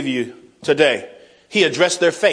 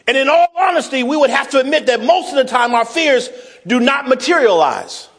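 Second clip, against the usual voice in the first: raised, carrying pulpit voice; preaching; 7 semitones higher than usual. Acted. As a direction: preach it out to the congregation. Preacher opening the sermon.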